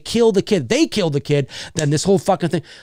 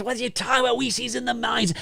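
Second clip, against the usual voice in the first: high, softer character voice; hammed-up querulous reply, pitched way up; Meeker; 7 semitones higher, 8 dB quieter, faster than usual. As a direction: answer back in a thin high voice. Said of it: "And Meeker's".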